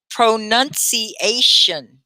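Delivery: slow, syllable-by-syllable "Pronunciation"; teacher spacing out each syllable of "Pronunciation"; slow pronunciation model. Shown as a demonstration. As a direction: model "Pronunciation" for learners to repeat. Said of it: "that's what you would do".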